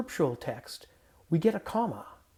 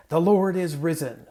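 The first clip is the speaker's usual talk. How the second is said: louder, emphatic Easter call; Easter greeting; 2 semitones higher, 8 dB louder than usual. Shown as a demonstration. Pastor opening the Easter greeting, proclaiming. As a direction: proclaim it to start the call and response. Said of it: "in the Easter groove mood".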